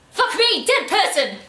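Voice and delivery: loud, high-pitched shocked outburst; exaggerated alarmed yell; mock shock. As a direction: act out freaking out, hammed-up. Acting the expected shocked reaction.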